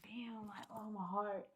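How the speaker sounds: whispered, very soft; whispered aside. Retold or imitated. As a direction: breathe it out, touched. Touched by her confession.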